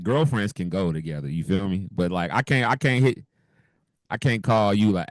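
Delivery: normal talking voice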